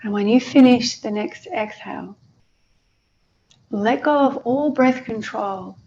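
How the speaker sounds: unhurried meditation guide with long pauses; guided meditation. Acted.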